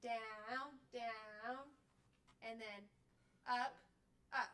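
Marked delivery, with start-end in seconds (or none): 0.0-1.6 each "down" stretched long and even; slow spoken count on "down"; drawn-out stroke call. Shown as a demonstration. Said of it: "And we actually say". 3.5-4.5 each "up" called out separately; measured count on "up"; spoken stroke call. Shown as a demonstration. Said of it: "And we actually say".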